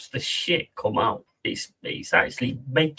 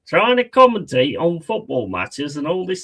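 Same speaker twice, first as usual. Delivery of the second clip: usual speaking voice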